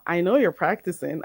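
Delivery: ordinary delivery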